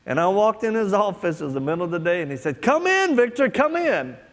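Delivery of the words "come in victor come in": much higher, louder, beaming welcome; fake-happy pastor greeting. Pastor welcoming the young man into his office, fake-cheerful. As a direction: gush over him like a too-cheerful pastor. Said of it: "he's happy".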